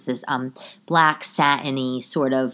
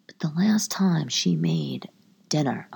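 hushed unvoiced aside; whisper; 4 dB quieter, slower than usual. Real, not acted. Vlogger confiding about grandmother's cooking, whispering. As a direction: lean in and whisper it.

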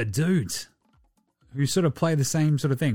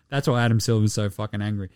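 normal talking voice

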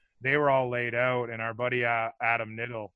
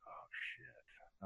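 breathless whispered oh shit; whispered curse; 8 semitones lower, 25 dB quieter than usual. Retold or imitated. Inner panic in a fight.

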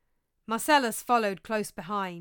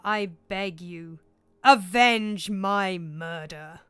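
slow, weighty ghostly pleading, swelling on key words; drawn-out, grave spectral voice; solemn ghost; 8 dB louder than usual. Acted. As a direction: intone it like a dead king begging revenge. Ghost speaks to Hamlet.

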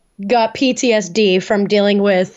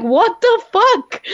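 much higher, slow drawn-out shocked question; stunned disbelief; 10 semitones higher, much slower than usual. Retold or imitated. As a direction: let the shock stretch each word. Girls reacting in disbelief.

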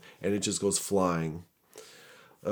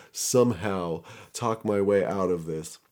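ordinary delivery, nothing marked

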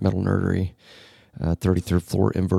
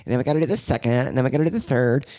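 higher, eager put-on stage voice; mock stage voice; 4 semitones higher, faster than usual. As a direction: parody a peppy radio host. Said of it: "This is our stage voice".

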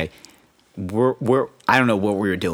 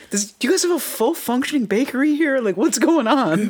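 mock amazement. Guest mock-shocked at the huge breakfast spread. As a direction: draw it out in disbelief.

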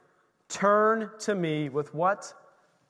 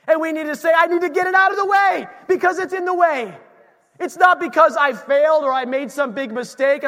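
shouted, pitch raised, driving hard; full-force preaching; 5 semitones higher, 7 dB louder than usual. Acted. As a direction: preach it out to the whole church.

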